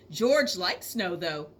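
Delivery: ordinary delivery, nothing marked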